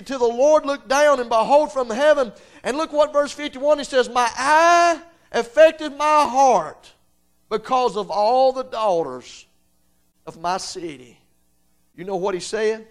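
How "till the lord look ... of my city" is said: loud, high scripture proclamation; impassioned revival preacher reading scripture; full-force preaching. Real, not acted. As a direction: proclaim the verse, stretching the key words.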